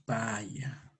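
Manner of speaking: Paya whispered, no voice. Shown as a demonstration. Teacher whispers Paya for class to copy. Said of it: "Repita conmigo".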